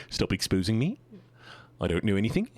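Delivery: Kiwi accent, each sentence a question; New Zealand accent. Shown as a demonstration. Streamer joking in a Kiwi accent, ends going up. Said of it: "every sentence is a question".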